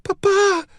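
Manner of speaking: put-on high, loud child's wail; a small child crying out in high-pitched distress. A narrator's reading. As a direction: wail it like a distraught little girl. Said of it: "Eloise wailed".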